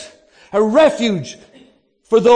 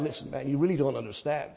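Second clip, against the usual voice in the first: mock complaint; 11 semitones lower, 14 dB quieter than usual. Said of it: "but when things are going bad".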